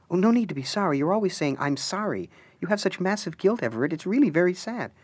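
high, sing-song scolding wife; chiding wife. Acted. Alice needling Everett about his guilt, high sing-song scolding. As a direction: pity him and needle him at once.

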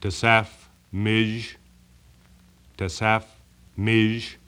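name spoken in reverse, slowly; backwards speech. Shown as a demonstration. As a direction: sound out the name backwards, syllable by syllable. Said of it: "Now I'll say it backwards".

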